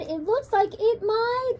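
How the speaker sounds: high, slow, unsure voice; puzzled teacher. A narrator's reading. Teacher guesses what the creation is, baffled. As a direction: grope for what it could be. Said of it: "the teacher said".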